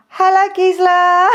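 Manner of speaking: high, giggly hello through laughter; nervous delighted giggle while greeting; laughing greeting. Real, not acted. Nervous live host greets a viewer, giggling high.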